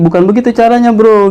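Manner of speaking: high, exasperated correction; brother angry again. Retold or imitated. Brother on call correcting his spell, irritated. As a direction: scold him for doing it wrong. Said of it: "abang gue marah lagi".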